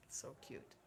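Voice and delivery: breathy whisper, no voice. Real, not acted. Host whispers to herself about the dogs, softly. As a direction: whisper it as if not to disturb.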